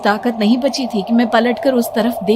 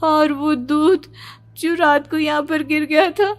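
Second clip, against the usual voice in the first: higher, shaken, near-crying voice; tearful fear; 4 semitones higher than usual. Acted. Daughter tells mother about the red milk, tearful fear. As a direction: insist through fearful tears.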